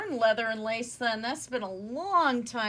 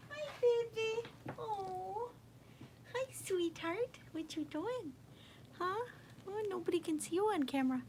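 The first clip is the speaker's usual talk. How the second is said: high, soft cooing; 7 semitones higher, 8 dB quieter than usual. Real, not acted. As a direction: coo gently at the kitten. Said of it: "talking to the kitten".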